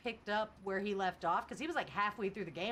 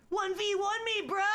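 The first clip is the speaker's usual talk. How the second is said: much higher, loud mock yell; mock shouted challenge; 10 semitones higher, 6 dB louder than usual. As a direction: shout the dare like a hyped kid. Reading chat challenge aloud.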